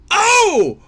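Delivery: high, loud shocked cry. Real, not acted. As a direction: gasp it out in shock. Reaction to the bad news.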